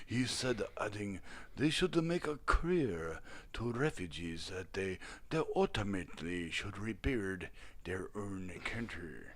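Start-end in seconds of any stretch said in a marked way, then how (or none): 1.5-9.2 put-on caricatured Tibetan accent, much lower and softer; mock Tibetan accent. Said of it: "my best offensive accent yet".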